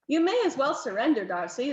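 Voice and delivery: plain reading voice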